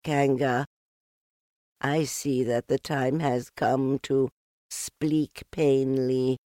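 little Piglet forcing courage; brave little voice. A narrator's reading. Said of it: "in as brave a voice as he could".

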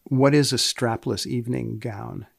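ordinary talk

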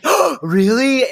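high, drawn-out feigned surprise; mock astonishment. [name] hears Cece may be missing.

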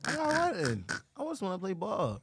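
high, light faux-innocent question; mock innocent. Repeats his innocent act.

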